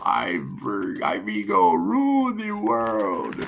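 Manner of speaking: hammed-up movie villain decree; Vigo again. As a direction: stretch out rule the world like a ghostly tyrant. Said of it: "came back to the sequel".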